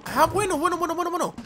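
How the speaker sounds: high, rapidly repeated exclamation; surprised realisation. Real, not acted. Player spotting a solution, surprised. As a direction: rattle it off high in sudden surprise. Sudden realisation while playing.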